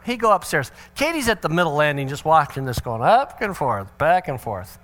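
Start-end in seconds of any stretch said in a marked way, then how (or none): none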